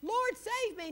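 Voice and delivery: high mock plea; mock cry for help. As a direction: act out Peter sinking and crying out. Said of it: "he cried out, saying".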